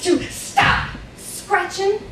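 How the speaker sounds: sudden emphatic warning; hammed-up stern warning from mama; scary threatening voice. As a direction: mimic a threatening mother's command. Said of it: "in this scary, loud, life-threatening voice".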